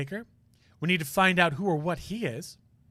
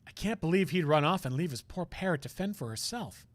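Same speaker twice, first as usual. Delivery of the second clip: usual speaking voice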